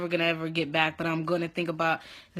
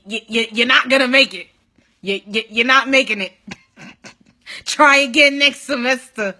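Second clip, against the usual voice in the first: louder stuttering imitation of staff; mock stutter, hammered verdict; mocking stammered verdict; 4 semitones higher, 8 dB louder than usual. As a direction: stammer out the bad news, loudly mocking. Mimicking clinical staff failing her.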